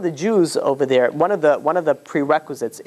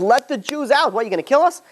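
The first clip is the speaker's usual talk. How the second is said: angry demand; 10 semitones higher than usual. Retold or imitated. Angry sons confront Paul.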